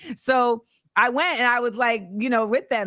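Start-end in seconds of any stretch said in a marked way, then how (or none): 0.1-0.2 high-pitched imitated call to join; hyped beckoning. Rappers calling the host along, mock hyped. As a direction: holler them along, way up high.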